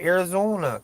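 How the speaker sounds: Minnesota, North Dakota long O in ARIZONA; Minnesota accent. Shown as a demonstration. Asking why Arizona brings it out, Minnesota accent. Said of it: "a Minnesota, North Dakota type accent".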